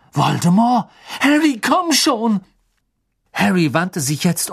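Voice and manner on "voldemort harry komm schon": panicked urging. A narrator's reading. Ron panics, urges Harry away.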